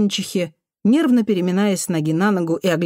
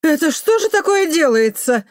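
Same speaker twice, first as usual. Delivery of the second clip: high, raised outraged voice; indignant call; 10 semitones higher, 4 dB louder than usual. A narrator's reading. Neighbour on the balcony over the front garden, indignant. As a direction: call out in shock from above.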